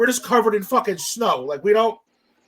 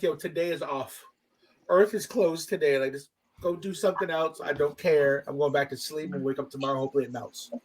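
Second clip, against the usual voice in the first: resigned dismissal; 7 semitones lower, 8 dB quieter than usual. Retold or imitated. Giving up, going back to bed.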